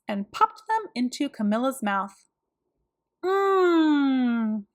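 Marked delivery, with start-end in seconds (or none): 3.2-4.7 thrilled yummy hum. A narrator's reading. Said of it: "said Camilla".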